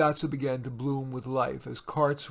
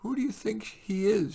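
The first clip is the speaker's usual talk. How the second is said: a hint of a worried little girl, pitched higher; lightly voiced little girl; 8 semitones higher than usual. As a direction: ask like a small anxious child. Child Leah voiced.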